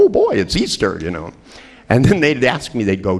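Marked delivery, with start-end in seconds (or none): 0.0-1.0 put-on much higher, gleeful oh boy; excited kid. Friends celebrating Easter, high gleeful excitement. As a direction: squeal about Easter like a child. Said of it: "friends that would go".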